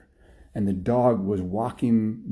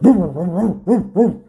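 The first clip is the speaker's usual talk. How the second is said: loud, high repeated woofs; dog bark; 9 semitones higher, 9 dB louder than usual. Acted. Buster the dog answering Jake's wish, loud high barking. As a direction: imitate a dog barking loudly. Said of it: "Buster the dog would bark".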